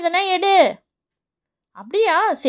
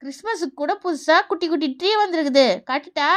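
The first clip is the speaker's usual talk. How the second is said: ordinary talk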